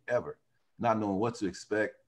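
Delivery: ordinary talk, nothing marked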